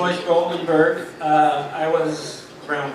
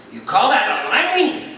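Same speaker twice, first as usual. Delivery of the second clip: higher, louder indignant retort; mock-angry aunt, pitched up and loud; 7 semitones higher, 4 dB louder than usual. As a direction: scold him, outraged, through laughter. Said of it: "looks at the guy really angry and she says".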